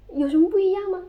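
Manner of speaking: puzzled student. Retold or imitated. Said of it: "我的学生".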